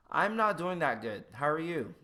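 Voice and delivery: high, loud upbeat reply; breezy challenge. Acted. Testing if the greeter listens, breezy loud reply. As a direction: say it like a cheerful greeting.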